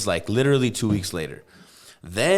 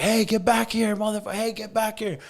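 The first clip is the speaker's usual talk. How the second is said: high-pitched yell of a man giving chase; high yelling chase call; 12 semitones higher than usual. Retold or imitated. Store guard yelling at the fleeing thief, high yell. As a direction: shout after the runner, pitched way up. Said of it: "And he's like".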